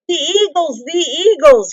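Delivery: high, loud joyful cry; excited shout. A narrator's reading. Bilbo spotting the eagles over the battle, excited shout. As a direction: shout it out in sudden hope. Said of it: "he shouted".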